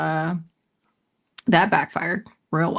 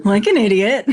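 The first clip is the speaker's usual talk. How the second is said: laughing through the words; 4 semitones higher than usual. Real, not acted. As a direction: laugh at your own foolishness. Laughing at her own blunder.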